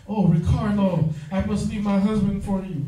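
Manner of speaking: hammed-up soap opera gush; swooning telenovela fan.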